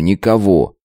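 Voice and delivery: plain reading voice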